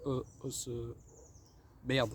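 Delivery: very low stumbling dope; low, soft goofy voice. Acted. Sketch guy playing Austria, low and fumbling. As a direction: drop low and fumble the word.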